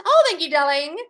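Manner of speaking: high, loud delighted cry; gushing thanks. Real, not acted. Host thanking viewer for lipstick compliment, gushing. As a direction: gush over the compliment.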